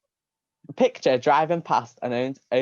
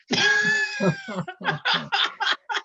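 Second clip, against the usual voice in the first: very high, long shriek of laughter. Real, not acted. Reader in fits over the shit bubble, shrieking laughter. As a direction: totally lose it at your own line. Reader loses it mid-story.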